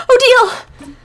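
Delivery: high, loud jolt of a name; startled cry. Acted. Character caught in the stall, startled shout of her name. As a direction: blurt her name in shock.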